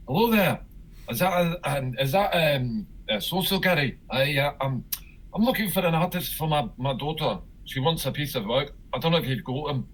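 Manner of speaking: phone a gallery as a fake Scot; bad Scottish accent. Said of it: "that really bad Scottish accent".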